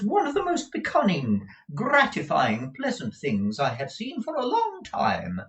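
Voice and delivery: high, emphatic, self-pleased mother; insist, gushing over each adjective; swooping high-voiced effusiveness; gushing Mrs. Nickleby.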